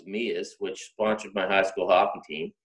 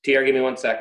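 usual speaking voice

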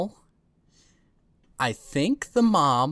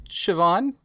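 hesitant, higher nervous voice; 7 semitones higher than usual. Acted. Martha nervously asks Siobhan for a private word. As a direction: stammer out a nervous request. Nervous player character asking.

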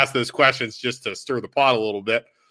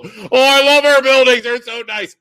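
gushing; 12 semitones higher, 7 dB louder than usual. Retold or imitated. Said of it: "and I know people".

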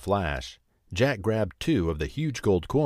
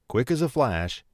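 plain reading voice

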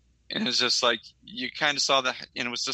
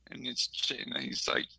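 ordinary talk

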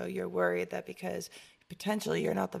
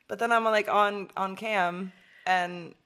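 normal talking voice

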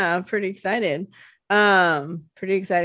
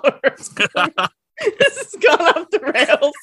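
laughing, high and slow; 8 semitones higher, 5 dB louder than usual. Real, not acted. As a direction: laugh through the words. Laughing at herself.

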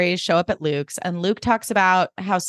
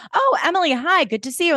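high, sugary greeting; falsely bright hello; fake-cheerful Suki; 10 semitones higher than usual. Suki greeting Emily with high fake cheer. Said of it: "there's this bit where Suki's like".

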